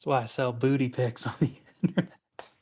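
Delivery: breaking into laughter mid-line; giggling through the words; laughing. Real, not acted. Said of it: "tried to say that without laughing".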